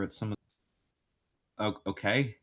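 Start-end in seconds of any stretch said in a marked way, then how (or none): none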